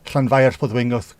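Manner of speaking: "Llanfair Pwdwyngoth" with a breathy Welsh ll and throaty ending; Welsh pronunciation. Shown as a demonstration. Streamer showing chat a Welsh place name, careful. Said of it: "So the Welsh".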